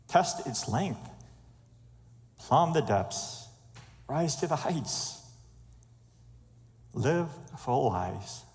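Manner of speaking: slow, spaced-out reading, each phrase its own invitation; deliberate devotional recitation, lingering on every phrase; scripture exhortation. Acted. Speaker reads Paul's letter to the congregation, slow exhortation.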